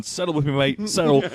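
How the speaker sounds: a mild Aussie lilt at normal volume; just a hint of the Australian guy; lightly voiced Aussie wrangler. Retelling the deer headlock, lightly voiced Aussie. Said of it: "a crazy Australian".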